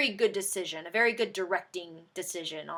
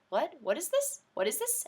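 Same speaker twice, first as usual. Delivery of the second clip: confused crowd; 7 semitones higher, 3 dB quieter than usual. Retold or imitated. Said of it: "Everyone was like".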